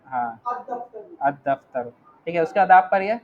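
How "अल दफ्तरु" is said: अल दफ्तरु said low, the corrected form; corrected pronunciation. Shown as a demonstration. Teacher models the corrected reading for the student. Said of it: "दाल देखिए आपका".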